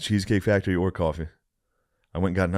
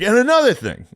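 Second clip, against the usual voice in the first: high, loud huffy comeback; mock indignant comeback; 6 dB louder than usual. Imagining storming back to the hostesses, mock indignant. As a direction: ham up the storming-back-in line.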